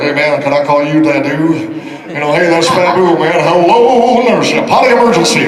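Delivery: loud rock-and-roll star impression. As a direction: play it as a big, hammed-up Southern crooner.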